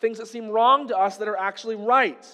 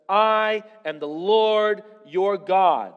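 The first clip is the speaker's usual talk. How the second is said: slow, louder, deliberate proclamation of "I am the Lord your God"; solemn voice of God; 6 dB louder, much slower than usual. Acted. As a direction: declare it slowly with full weight, as God speaking. Quoting God's words in scripture.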